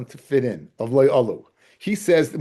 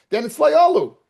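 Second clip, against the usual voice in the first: emphatic; 13 semitones higher, 6 dB louder than usual. Real, not acted. Teacher drives home the ruling.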